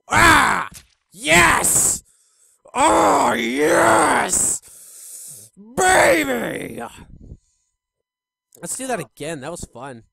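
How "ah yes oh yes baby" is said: loud, drawn-out cries of triumph; loud exulting. Real, not acted. Player celebrating a game kill, loud exultant cries. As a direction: holler it out, stretching each word.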